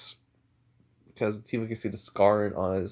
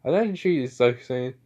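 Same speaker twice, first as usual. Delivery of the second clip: plain reading voice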